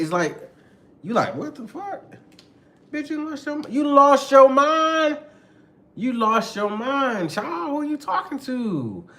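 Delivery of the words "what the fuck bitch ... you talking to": very high-pitched mock disbelief; incredulous outrage. Partner reacting to being called out, high and incredulous. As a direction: squeal it, drawn out and offended. Acted outraged reply in quarrel.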